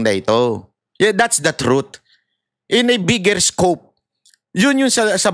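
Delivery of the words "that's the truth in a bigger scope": usual speaking voice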